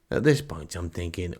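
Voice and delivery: ordinary delivery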